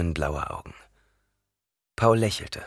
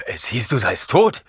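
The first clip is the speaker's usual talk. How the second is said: rasping, higher and louder; death rattle; 4 semitones higher, 6 dB louder than usual. Acted. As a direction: rasp it out in shock. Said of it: "röchelte Gurney". (röchelnd, heiser ausgestoßen)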